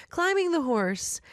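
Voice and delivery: ordinary talk, nothing marked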